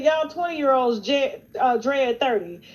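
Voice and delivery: loud teasing warning; loud mock warning about turning thirty; retold loud taunt. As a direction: raise the voice and tease. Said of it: "I said".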